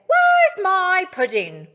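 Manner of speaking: put-on proper British accent. Animals asking Farmer Bill, proper British accent. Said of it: "a proper British accent".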